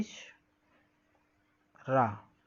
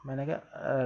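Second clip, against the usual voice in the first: usual speaking voice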